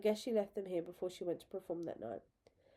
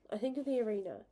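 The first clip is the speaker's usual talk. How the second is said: plain reading voice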